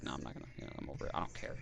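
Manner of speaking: muttered, almost voiceless; under his breath. Retold or imitated. A man quietly muttering that he no longer cares. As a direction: mutter it to yourself, done with it.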